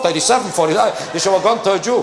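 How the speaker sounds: usual speaking voice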